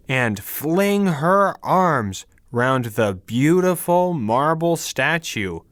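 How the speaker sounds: usual speaking voice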